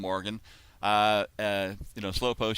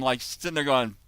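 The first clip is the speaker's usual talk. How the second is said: usual speaking voice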